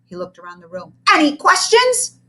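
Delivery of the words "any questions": high, loud, slow challenge; daring question. Acted. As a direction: dare them to ask. Tyrant teacher.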